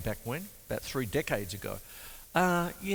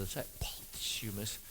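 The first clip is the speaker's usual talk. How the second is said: whisper; 4 semitones lower, 13 dB quieter than usual. Real, not acted. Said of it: "if I can get my lips together".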